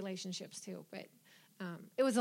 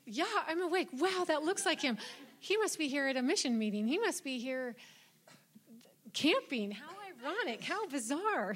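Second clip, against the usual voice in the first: amazed disbelief; 6 semitones higher than usual. Retold or imitated. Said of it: "you hear about it in the movies".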